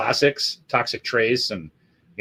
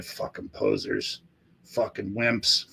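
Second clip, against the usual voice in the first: low, soft, slow scoff; quiet disgusted mutter; scornful mutter; 3 semitones lower, 10 dB quieter, slower than usual. Retold or imitated. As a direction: sneer it under your breath.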